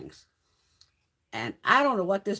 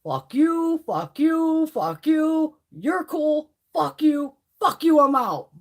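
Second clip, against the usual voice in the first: higher, loud mock yell down the line; Half Baked-style shouted kiss-off, higher and louder; imitating Pedro's rant; 8 semitones higher, 8 dB louder, much slower than usual. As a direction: rattle off each fuck you like quitting over the mic. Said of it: "he gets on the mic and he's like".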